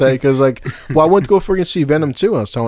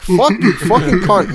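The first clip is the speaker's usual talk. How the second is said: higher, mock-angry comeback; mock outrage; 5 semitones higher, 4 dB louder, slower than usual. Man retelling his comeback to her teasing, mock outraged. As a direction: blurt a playful curse in pretend fury.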